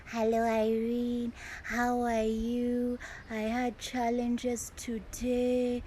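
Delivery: put-on high, slow little-girl voice; childlike voice. Shown as a demonstration. Speaking coach imitating her client, childlike and slow. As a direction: sound like a small child greeting her teacher. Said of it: "I can decide to sound like how she sounds".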